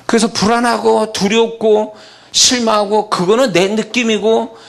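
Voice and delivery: fiery sermon voice; full-force preaching. Acted. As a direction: drive the point home to the congregation.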